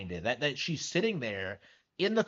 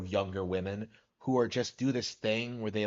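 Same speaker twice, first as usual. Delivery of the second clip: ordinary talk, nothing marked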